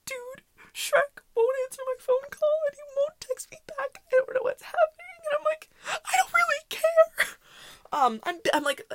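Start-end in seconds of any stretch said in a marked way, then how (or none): 0.1-7.3 high, crying mock voice of a distraught friend; upset friend. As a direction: whine it high like she's crying. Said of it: "just so upset".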